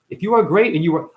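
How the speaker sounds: normal talking voice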